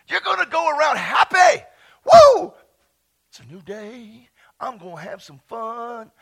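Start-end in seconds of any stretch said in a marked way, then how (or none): 0.1-1.6 loud, rising shout of happiness; happy shouted high; shouted joy. Acted. Preacher rouses congregation. 2.0-2.5 high loud woo; sharp celebratory whoop; joyful whoop. Real, not acted. Preacher whoops for joy.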